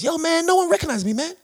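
very high-pitched American comedian impression; hammed-up high American voice; Chris Tucker. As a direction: squeal it in mock amazement. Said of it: "Chris Tucker came over".

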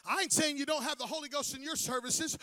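normal talking voice

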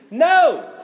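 hammed-up refusal; playful yell of no; mock shout.

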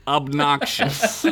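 air quotes. Acted.

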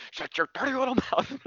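high, hammed-up nasty scolding; mock mean parent. Dad jokingly scolds toddler, hammed-up mean voice. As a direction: pretend to snap at the kid, in jest.

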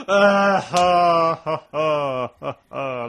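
loud, spelled-out laugh fading down; forced ha ha. Real, not acted. Host groaning at co-host's pun, mock laugh fading. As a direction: laugh it out then wind down.

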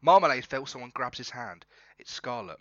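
ordinary delivery

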